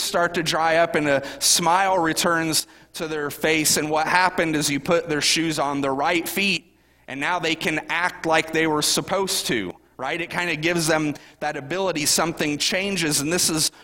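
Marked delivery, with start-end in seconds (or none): none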